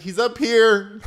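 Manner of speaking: high, loud, slow call. Retold or imitated. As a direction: call to someone rows away, imitated. Calls to owner down the plane.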